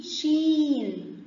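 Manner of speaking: "شين" very slow, ee stretched out; long ee. Shown as a demonstration. Teacher naming the letter for pupils, "شين" with long ee. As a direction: draw out the ee before the final n of "شين". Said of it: "مد بالياء".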